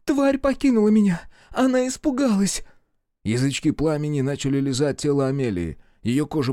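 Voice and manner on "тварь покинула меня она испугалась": высокий громкий плач-крик; в панике, высоко и громко; отчаяние. A narrator's reading. Амелия в отчаянии. (Амелия в отчаянии кричит Люку)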